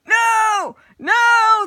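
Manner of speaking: very high, loud screaming; screamed. Acted. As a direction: scream it in despair. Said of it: "the goblin screamed".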